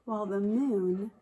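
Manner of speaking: slowly drawn-out words; slow stretched. Acted. Teacher stretching the moon line slowly.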